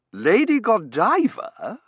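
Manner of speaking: high, loud, slow matronly query; regaining her old self. A narrator's reading. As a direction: echo it slowly, with recovered force. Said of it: "sounding more like her old self".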